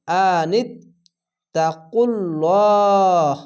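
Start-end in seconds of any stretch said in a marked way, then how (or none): 1.6-3.4 তাকুল্লাহ very slow, last vowel held long; three-beat stretch. Shown as a demonstration. Teacher showing a long pause-stop, very slow. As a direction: hold the end of তাকুল্লাহ three counts. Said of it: "তিন আলিফ টেনে থেমে যাবেন".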